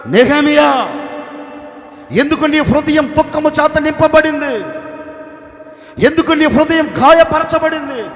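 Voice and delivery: the king demanding loudly why he grieves; loud, pressing royal questioning; king loudly questioning his grieving servant; king's loud questions. Acted. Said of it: "నెహెమియాతో రాజు అంటున్నాడు".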